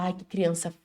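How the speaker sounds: lento e dramático, com suspiro de susto; mãe exagerada. Retold or imitated. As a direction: exagere a bronca teatral. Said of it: "você fala". (mãe fingindo escândalo com a criança)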